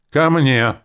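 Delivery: high, loud, sharp order; sharp command. A narrator's reading. Hero summoning the archon to him, sharp shouted command. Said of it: "сказал резко".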